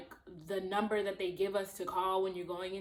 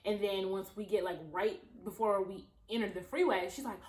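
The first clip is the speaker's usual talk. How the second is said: ordinary delivery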